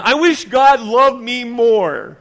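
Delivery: loud, high and drawn-out lament; acted loud self-pitying complaint. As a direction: wail it slowly at full voice. Voicing a believer's loud complaint.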